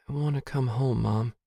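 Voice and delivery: small, weary voice; listless voice. A narrator's reading. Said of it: "says the listless voice".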